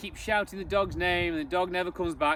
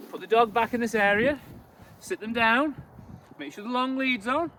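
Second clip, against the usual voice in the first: ordinary talk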